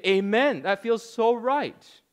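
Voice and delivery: mocking sheep swooning in agreement; mock amen. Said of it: "and they clap, and they say".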